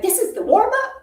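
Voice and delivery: high, incredulous squeak at the warm-up; mock disbelief. Imitating a worn-out student, mock disbelief. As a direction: play a shocked student asking if this is only the warm-up. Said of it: "they're like".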